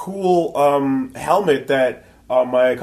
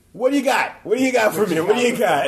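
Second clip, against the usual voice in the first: eager mock demand; 9 semitones higher, faster than usual. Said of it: "came up to him, like".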